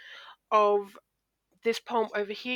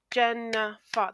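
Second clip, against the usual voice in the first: "Jennifer" slowly split into three beats; syllable by syllable; slower than usual. Shown as a demonstration. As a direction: clap out "Jennifer" as Jen-ni-fer. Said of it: "That's three syllables in my name".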